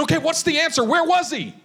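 high-pitched insistent questioning; urgent demand. Retold or imitated. Retold urgent questioning of the man.

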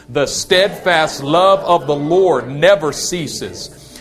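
slow, loud and emphatic declaration; nice and loud. Shown as a demonstration. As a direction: lead the congregation, slow and loud. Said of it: "Say it nice and loud".

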